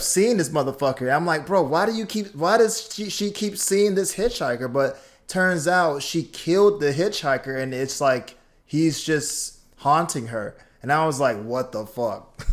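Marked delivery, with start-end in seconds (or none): 1.5-4.7 higher, louder retold bafflement; recounted incredulous question, pitched up; baffled disbelief. Retelling baffled reaction to the show. 11.4-12.4 slow retold disbelief, breaking into a laugh; recalled bewilderment with a laugh; amused disbelief. Retelling stunned reaction, then laughs.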